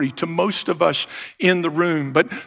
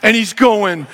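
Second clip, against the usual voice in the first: ordinary delivery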